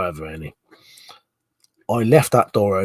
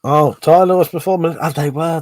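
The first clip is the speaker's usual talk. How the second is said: louder, higher burst of remembering; surprised recall; sudden realization; 6 semitones higher, 7 dB louder than usual. Real, not acted. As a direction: it just came back to you. Sudden recollection exclamation.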